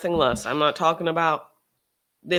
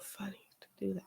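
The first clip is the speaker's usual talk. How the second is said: whisper; 17 dB quieter, faster than usual. Real, not acted. Whispered aside.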